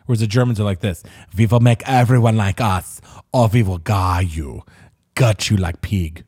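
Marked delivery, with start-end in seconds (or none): 1.4-6.2 mock German accent. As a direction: threaten like a cartoon German villain. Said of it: "That's a horrible accent".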